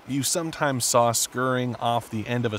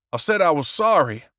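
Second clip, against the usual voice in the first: raised, insistent protest; 4 semitones higher, 4 dB louder than usual. A narrator's reading. Author protesting to the agent, insistent. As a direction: push back hard on the agent. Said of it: "Labane protested".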